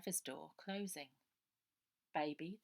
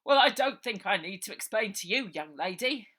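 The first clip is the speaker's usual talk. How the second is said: pompous policeman; 3 semitones higher, 14 dB louder than usual. Acted. Sergeant answers Ida.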